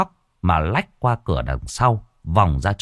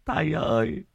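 whimpering plea; 14 semitones higher than usual. A narrator's reading. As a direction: sob it out to the masters. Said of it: "vẫn cứ mếu máo". (mếu máo van nài)

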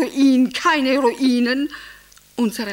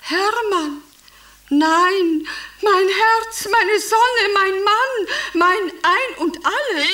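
gushing adoration; 9 semitones higher, slower than usual. Acted. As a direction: pour it out like a lovesick wife. Gushing over husband on phone.

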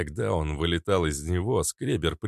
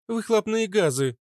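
joking; 3 dB louder than usual. Acted. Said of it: "пошутила Фитилёк".

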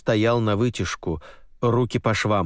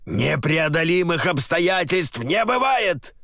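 furious shout; 8 semitones higher, slower than usual. Acted. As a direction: snap it back in rage. General raging.

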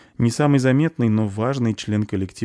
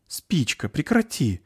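protesting; 8 semitones higher, slower than usual. A narrator's reading. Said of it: "толкнул её крошечным кулачком".

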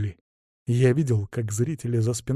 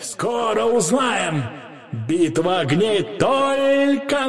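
high, slow, drawn-out arena herald calls; town crier; 11 semitones higher, much slower than usual. Acted. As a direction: work the crowd like a showman announcer. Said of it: "Услышал голос глашатая".

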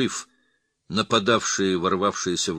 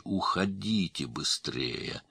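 slow, softer strained plea; hoarse pleading; 2 semitones lower, 6 dB quieter, slower than usual. A narrator's reading. Wounded father begs the others to hurry away, slow hoarse plea. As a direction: draw the words out, weakly begging. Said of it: "прохрипел он умоляюще".

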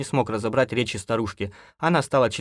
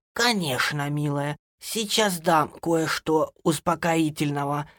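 put-on high, slow old woman's voice; bossy granny; 8 semitones higher, slower than usual. A narrator's reading. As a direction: take charge, high and unhurried. (бабушка распоряжается, высоко)

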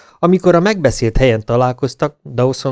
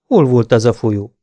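plain reading voice

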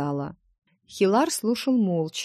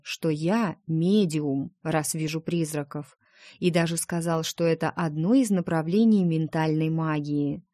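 usual speaking voice

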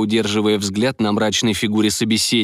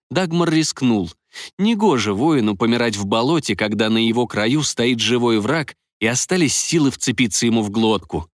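ordinary delivery, nothing marked

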